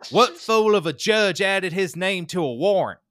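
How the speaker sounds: high, loud outburst at the judge; indignant yelling. Retold or imitated. Rider bursting into court in session, angry mock yelling. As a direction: yell it like an outraged defendant. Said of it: "started cursing and yelling".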